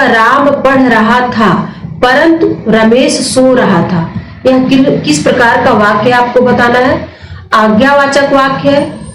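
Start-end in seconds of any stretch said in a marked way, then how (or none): 0.0-4.1 slowed down, reading the example sentence clearly; slow dictation. Shown as a demonstration. Teacher slowly dictating the example sentence to students. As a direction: read the sentence slowly for the class. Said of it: "तो वाक्य है आपका".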